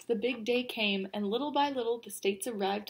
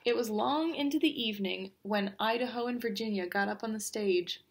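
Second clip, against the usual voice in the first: usual speaking voice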